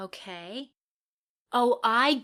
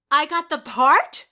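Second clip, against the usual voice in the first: high, loud, laughing shout of joy; can't believe it, shouting with delight; overjoyed disbelief; 8 semitones higher, 11 dB louder than usual. Real, not acted. Hearing she got the part, shouting with laughing joy.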